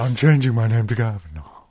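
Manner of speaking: affected put-on character voice; stagey, affected announcement; Kavanaugh voice. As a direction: announce it in a mock-grand voice. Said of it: "we named that voice".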